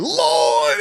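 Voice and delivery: ordinary talk, nothing marked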